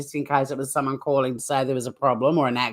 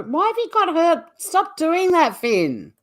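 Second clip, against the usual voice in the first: exasperated; 11 semitones higher than usual. Real, not acted. Host annoyed at co-host's interruption.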